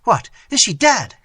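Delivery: high, loud shocked cry. Acted. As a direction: blurt it out in horror. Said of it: "cried Theodore".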